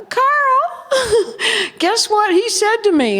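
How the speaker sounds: put-on calling to Carl in a high girlish voice; girlish plea. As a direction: play the wide-eyed girl running to tell on him. Retelling running to Carl.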